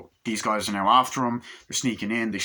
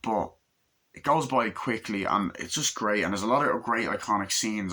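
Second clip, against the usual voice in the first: usual speaking voice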